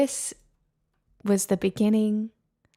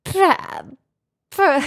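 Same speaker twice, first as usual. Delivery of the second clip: loud frustrated try; 6 semitones higher, 7 dB louder than usual. Shown as a demonstration. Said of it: "I literally can't do it".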